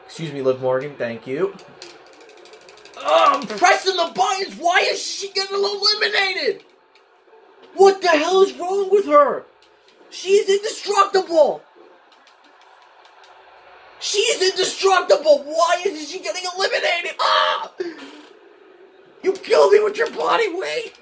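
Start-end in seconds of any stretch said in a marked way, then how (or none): 3.0-17.7 high, strained shouting at the game; frustrated yelling. Real, not acted. Losing fight, frustrated at game. 19.2-20.9 high, strained shouting at the game; frustrated yelling. Real, not acted. Eliminated by opponent in game.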